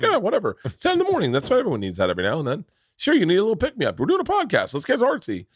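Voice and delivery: mock your own careless thought, up high; mock inner voice. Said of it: "In my head, I'm like".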